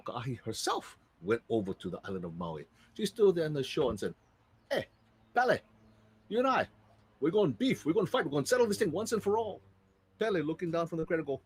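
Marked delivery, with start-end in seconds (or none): 4.7-9.6 challenging big sister. Acted. Sister challenges Pele to fight.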